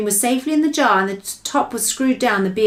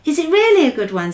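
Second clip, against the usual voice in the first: put-on higher, louder eager girl; eager little girl; 7 semitones higher, 5 dB louder than usual. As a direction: play Sophie, eager and curious.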